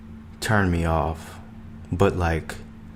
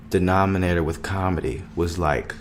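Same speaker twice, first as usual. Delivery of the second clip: ordinary delivery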